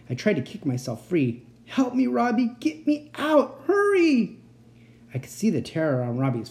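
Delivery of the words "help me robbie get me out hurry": very high plea, climbing to a scream; desperate screaming. A narrator's reading. Captive child begs the boy for rescue, screaming. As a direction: build to a drawn-out panicked shriek.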